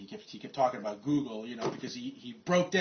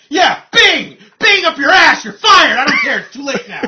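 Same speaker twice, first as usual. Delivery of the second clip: mocking scream, much higher and louder; furious boss; 12 semitones higher, 19 dB louder than usual. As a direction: spit it out with contempt. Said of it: "he's going".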